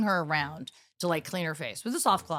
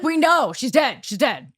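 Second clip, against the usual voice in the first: higher, louder, then settling down; exasperated mom; 5 semitones higher, 6 dB louder than usual. Retold or imitated. Mom tells daughter to drop it, raised then fading. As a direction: repeat it wearily to end the topic.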